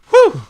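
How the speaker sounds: loud, very high exhale; sharp high "whew" of relief; big whew. Real, not acted. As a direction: let off steam with a high whoop. Venting after getting worked up.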